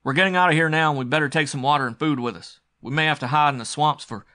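loud, urgent orders; calling out. Acted. McGrath readying their escape with Ali, calling out urgently. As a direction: raise the voice and press to get moving. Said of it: "McGrath called".

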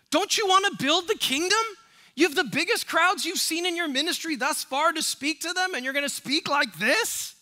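mock outraged churchgoer. Suburban churchgoers scolding Jesus, hammed-up high outraged voice. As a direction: squeal like a scandalized suburbanite.

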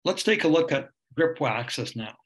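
normal talking voice

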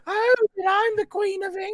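very high sarcastic screech; squawking mock charlady; scroungy cleaning lady. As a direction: scoff in a shrill Python drag falsetto. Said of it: "and they're like".

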